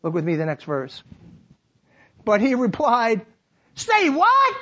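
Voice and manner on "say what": high, loud startled "Say what?"; Peter's casual retort. Acted. Pastor's Peter brushing off Jesus, high and startled. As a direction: toss it back in a much higher disbelieving voice. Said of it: "Mulligan translation".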